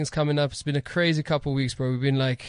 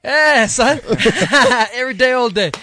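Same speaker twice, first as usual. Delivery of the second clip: loud, high hype call; hyped-up shout; 8 semitones higher, 9 dB louder than usual. Real, not acted. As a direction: shout it out to the room. Hype answer to the host.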